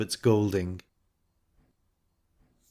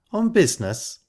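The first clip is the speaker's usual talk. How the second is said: high-pitched, loaded little query; imply far more than the words; meaningful; 10 semitones higher, 3 dB louder than usual. A narrator's reading. Ida questioning Golding's visits, with pointed meaning. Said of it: "with considerable meaning underlying the words".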